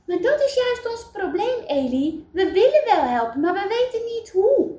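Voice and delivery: exasperated protest. Acted. Wout explaining to Eli that they cannot help, exasperated. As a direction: pour out the problem in growing exasperation.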